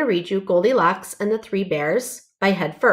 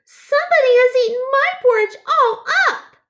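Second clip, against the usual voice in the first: very high, loud little bear wail; high, loud baby bear cry; 5 dB louder, faster than usual. A narrator's reading. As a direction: squeal it out like a tiny bear whose breakfast is gone. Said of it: "cried Baby Bear".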